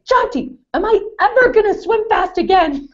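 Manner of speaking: dramatic whine. Retold or imitated. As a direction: play up the drama like a crushed teen. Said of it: "It was so dramatic".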